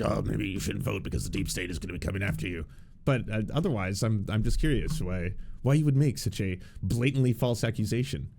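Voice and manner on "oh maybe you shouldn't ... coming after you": lower-pitched mock conspiracy-host voice; Alex Jones. Streamer mimicking a host warning of the deep state, Alex Jones voice. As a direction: put on a low conspiracy ranter's voice. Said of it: "Was I doing an Alex Jones voice".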